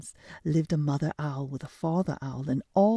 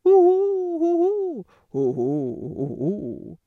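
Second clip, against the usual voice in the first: high loud hoots falling away; hooting; 9 semitones higher, 7 dB louder, slower than usual. Acted. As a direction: hoot then fade down. Owl hooting.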